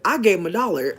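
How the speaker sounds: mock shouted cartoon mom line; imitating Chef Mom. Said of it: "When Chef Mom said".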